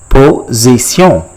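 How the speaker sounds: "Position" slowly as French po-zee-syon; French pronunciation. Shown as a demonstration. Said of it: "in French is called".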